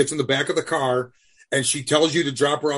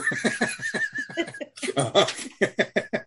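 high laugh; 7 semitones higher, 4 dB quieter than usual. Real, not acted. Reacting to a picture on screen.

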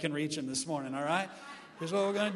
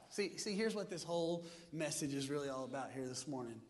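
ordinary delivery

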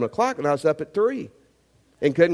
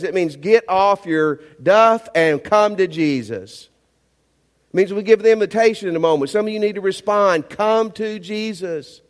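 full-force preaching; 2 semitones higher, 4 dB louder than usual. Acted. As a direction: preach it out, pleading with the room.

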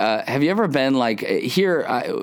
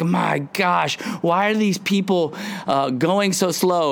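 higher, hammed-up exasperated whine; mock frustration; 7 semitones higher than usual. Driver stuck in traffic grumbles, high mock exasperation. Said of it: "You're like".

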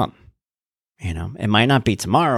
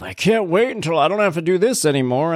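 mock complaining; 8 semitones higher than usual. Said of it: "People would always say that stuff".

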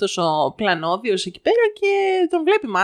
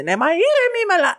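exasperated mother, acted; 8 semitones higher than usual. Mother answering the vendor's plea for calm, exasperated retold outburst. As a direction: insist you are calm while boiling over.

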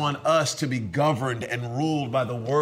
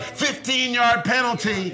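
mock referee call; 8 semitones higher than usual. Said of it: "We're like".

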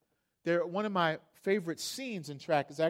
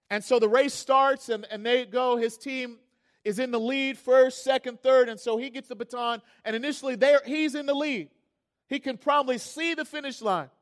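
higher, driving race account; excited race retelling; 4 semitones higher, 7 dB louder than usual. Retold or imitated. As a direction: tell it like the race is live. Tells the race as it unfolds.

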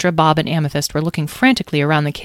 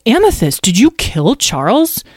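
slower, louder dismayed question; shocked reproach; 6 semitones higher, 6 dB louder than usual. Acted. Azriel confronts Amethyst over Charles, shocked. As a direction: ask a friend in disbelief.

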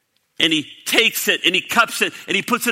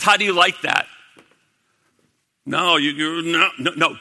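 ordinary talk

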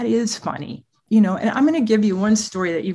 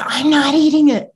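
put-on higher, louder whiny teen refusal; imitating angry teen; 7 semitones higher, 8 dB louder than usual. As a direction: play the sulky fourteen-year-old digging in. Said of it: "He goes".

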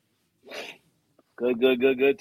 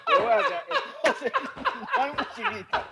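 high laughing voice. Real, not acted. Laughing while talking.

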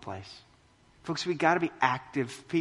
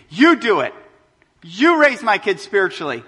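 loud, pushy parent handing off the job; mock demanding parent; 5 semitones higher, 10 dB louder than usual. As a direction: hand the kids off loudly, like a parent dumping a chore. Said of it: "you bring them here to church and say".

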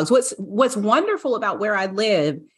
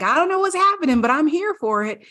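much higher, cheerfully confused voice; clueless dog voice; 8 semitones higher, faster than usual. Pretend. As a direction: play a happy dog who doesn't get it. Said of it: "And Bella was like".

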